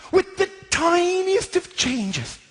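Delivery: high, slow, drawn-out build-up; dramatic emphasis. Shown as a demonstration. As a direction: stretch each word for suspense.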